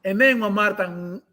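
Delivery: ordinary delivery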